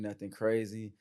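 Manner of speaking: ordinary talk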